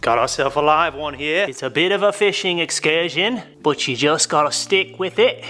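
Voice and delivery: put-on jokey Australian outback accent drifting English; a fisherman's Australian accent, half slipping into English; mock Australian accent. Car guy hamming an Australian accent to his viewers. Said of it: "I was trying to do Australian, but then I turned, like, English".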